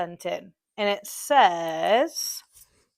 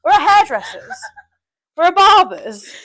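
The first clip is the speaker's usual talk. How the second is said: indignant sarcasm; 6 semitones higher, 6 dB louder than usual. Retold or imitated. Mocking the rude customer.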